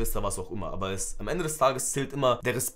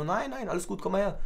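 softer, higher retold reassurance; placating, lightly raised pitch; coaxing reassurance; 5 semitones higher, 3 dB quieter than usual. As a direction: soothe a kid lightly, pitched up.

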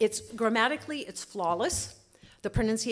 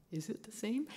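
whisper; 2 semitones higher, 7 dB quieter than usual. Real, not acted. Teacher doubting her own claim.

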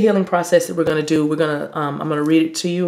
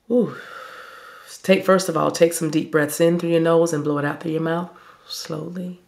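normal talking voice

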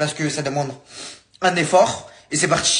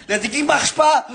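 exasperated, higher and louder complaint; frustrated outburst; 10 semitones higher, 5 dB louder than usual. Real, not acted. Youtuber fed up that the voice trick failed, exasperated outburst.

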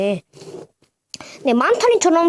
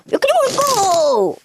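battle cry; 6 semitones higher than usual. Pretend. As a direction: call out a cartoon hero's catchphrase, stretched. Calls toy's battle launch cry again.

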